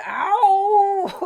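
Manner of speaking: high, loud howl trailing out long; wolf's pained howl. Acted. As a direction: let out a long cartoon wolf howl. Wolf howls when confronted.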